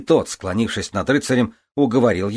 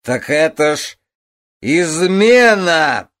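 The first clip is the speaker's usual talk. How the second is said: drawn-out, loud, tipsy outburst; tongue tripping over the words; slurred drunk; 10 semitones higher, 10 dB louder, much slower than usual. Acted. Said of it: "заплетающимся языком проговорил Артур". (заплетающимся языком)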